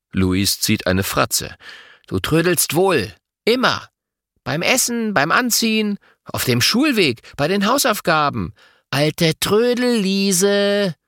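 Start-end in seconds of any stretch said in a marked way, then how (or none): 2.1-10.9 teasing. A narrator's reading. Boy teases sister.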